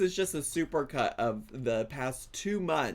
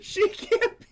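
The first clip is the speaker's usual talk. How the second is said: laughing disbelief; 6 dB louder than usual. Real, not acted.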